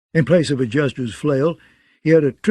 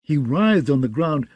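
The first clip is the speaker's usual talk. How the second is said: ordinary delivery, nothing marked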